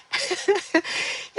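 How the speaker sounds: squeaky silent laugh bursting out; can't hold the giggle in; high breathless giggle. Real, not acted. High giggle as she recalls the mixup.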